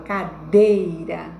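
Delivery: cadeira with a strong, long DEI; ca-DEI-ra, middle syllable punched; stress on middle syllable. Shown as a demonstration. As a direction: lean hard on dei in cadeira. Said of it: "a mais forte ficou aqui".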